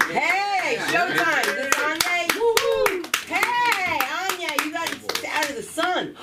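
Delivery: high-pitched whooping hello; excited greeting. Real, not acted. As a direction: call out to a new arrival, high and excited. Host greets arrival as show starts.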